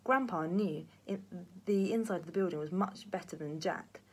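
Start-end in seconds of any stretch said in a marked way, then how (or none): none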